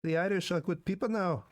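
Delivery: a lighter touch of mock Irish brogue; fading Irish accent. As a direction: ease out of the Irish voice. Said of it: "That was a perfect Irish accent".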